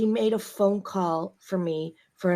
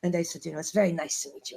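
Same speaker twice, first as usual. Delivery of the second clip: ordinary talk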